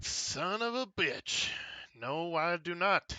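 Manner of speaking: dismayed realization. Acted. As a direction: let the realization sink in slowly. Player realizes the lost memory.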